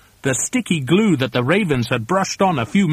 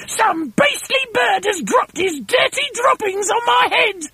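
very high, outraged shriek; indignant screechy wife; Missus Twit crying out. A narrator's reading. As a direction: screech it in high-pitched disgust. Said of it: "cried Missus Twit".

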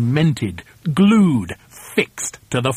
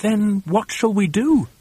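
higher, mildly alarmed plea; lightly voiced worried monkey; Mugglewump crying out; 7 semitones higher than usual. A narrator's reading. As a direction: ask with a touch of dismay. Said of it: "cried Mugglewump".

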